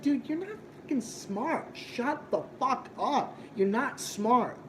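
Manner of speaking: a hint of parroting a smug debater; mocking voice. Streamer imitating how Hunter talks, mocking. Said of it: "drones making fun of you, their voice".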